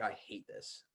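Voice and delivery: breathy, voiceless mock complaint under the breath; sulky whisper of a bored student; whispered gripe. Student griping to himself in class, whispered.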